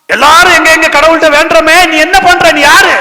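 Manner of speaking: very high, loud interrogation; angry shouted challenge. Acted. Captain demanding who Jonah is while all pray, angry shout. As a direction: build an indignant shout at him. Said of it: "captain வந்து கேக்குறான்".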